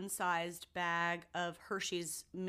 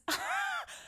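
shrieking laugh. Real, not acted. Reaction to bite of chocolate.

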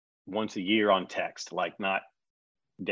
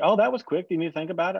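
high, louder surprised producer; mock surprise; 9 semitones higher, 4 dB louder, faster than usual. Producer surprised he said yes so fast, high mock surprise. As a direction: play her amazed at how fast he agreed.

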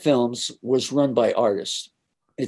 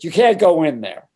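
louder, higher blocking order; yelling; 5 semitones higher, 7 dB louder than usual. Retold or imitated. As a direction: bark it like a gatekeeper. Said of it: "she yells at me".

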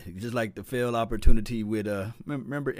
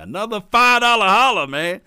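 hype announcement; 7 semitones higher, 10 dB louder than usual. Real, not acted. Announcing another donation.

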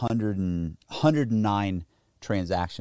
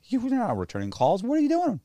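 much higher, pestering female imitation; imitated nagging girlfriend; 13 semitones higher than usual. Girlfriend confronting him as he packs, high-pitched nagging. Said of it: "she's like".

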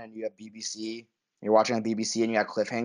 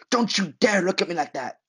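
mock angry; 6 semitones higher, 4 dB louder than usual. Polly angry at Tommy retold.

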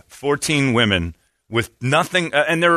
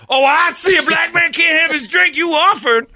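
Donnell Rawlings. Retold or imitated. Comedian baiting a host, high accusing shout. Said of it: "he goes".

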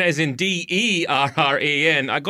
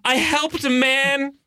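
mock melodramatic confession; 11 semitones higher than usual. Parodying Janet's hidden backstory in a high overwrought voice. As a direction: ham up a tragic secret.

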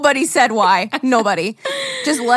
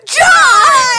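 loud excited shout of a name; 12 semitones higher, 8 dB louder than usual. Retold or imitated. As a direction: yell the name in sudden delight.

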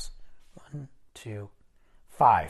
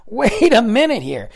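higher, louder double take; startled realization; 7 semitones higher, 10 dB louder than usual. Retold or imitated. Speaker reacting to Italian girls laughing and talking, startled realization. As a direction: draw out a loud, high "wait" as it dawns. Acting out his dawning realization.

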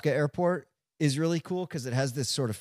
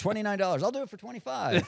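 hawker voice; 6 semitones higher, 3 dB quieter than usual. Retold or imitated. Pilot quoting price.

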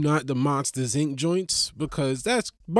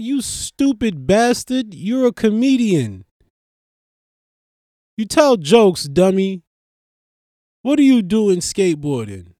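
higher and louder, exasperated; mock scolding; 5 semitones higher, 6 dB louder than usual. Colleagues scolding the comic for skateboarding, loud and exasperated. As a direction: berate a foolish friend. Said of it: "They gonna be like".

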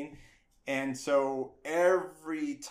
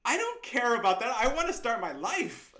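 eager; 10 semitones higher, 3 dB louder than usual. Retold or imitated. Insisting to the counselor.

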